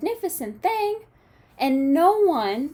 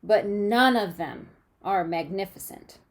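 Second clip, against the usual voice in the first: expressive picture-book read-aloud; reading to children. A narrator's reading. Teacher reading a storybook to her class, lively and expressive.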